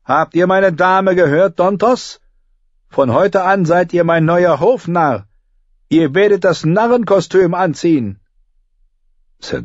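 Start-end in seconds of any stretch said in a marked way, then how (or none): 0.0-8.2 put-on boy king raising his voice; raised voice. A narrator's reading. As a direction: call it out to the whole court. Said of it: "Er hob die Stimme".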